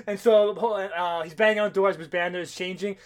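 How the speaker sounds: usual speaking voice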